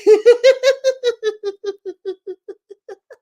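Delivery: loud high peal of laughter; group laughter exercise laugh; loud laughing fit. Real, not acted. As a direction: burst out laughing for real. Laughter yoga peek exercise.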